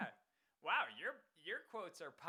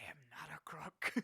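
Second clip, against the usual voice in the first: breathy whisper of a famous line; mock ASMR whisper; 4 dB louder than usual. Streamer whispers a famous presidential denial as ASMR. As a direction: hush it like a bedtime secret.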